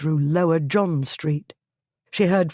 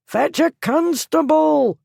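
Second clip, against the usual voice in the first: much higher, loud ragged yell; desperate shout; 11 semitones higher, 5 dB louder than usual. A narrator's reading. Lord shouting again and again for help, desperate shout. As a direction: yell it until your throat gives out. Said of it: "he shouted".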